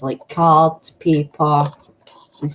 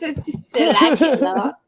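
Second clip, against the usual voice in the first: higher, laughing put-on posh English; fake English accent; 9 semitones higher than usual. Shown as a demonstration. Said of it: "Is that your English accent?".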